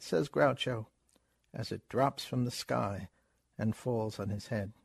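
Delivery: ordinary delivery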